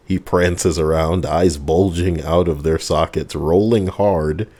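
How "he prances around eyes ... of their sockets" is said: ordinary talk, nothing marked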